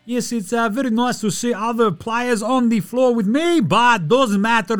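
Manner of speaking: high-pitched, hammed-up Serbian accent; attempted Serbian accent. Host imitating the Joker, dodgy Serbian accent. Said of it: "I don't know what that accent was".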